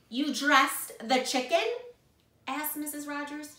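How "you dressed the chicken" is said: slow, loud, disbelieving question; aghast question. A narrator's reading. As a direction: ask it loudly, higher, in disbelief. Missus Rogers is aghast.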